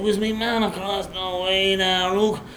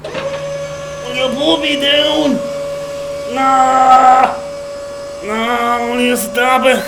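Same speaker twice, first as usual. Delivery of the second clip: panicked begging; 3 semitones higher than usual. Acted. Man dangled in the air begs to be put down, panicked. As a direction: plead frantically for release.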